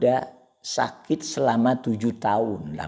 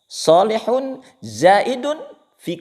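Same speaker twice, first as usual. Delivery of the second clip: 1 proclaimed; 13 semitones higher, 9 dB louder, slower than usual. Real, not acted. Arabic phrase proclaimed.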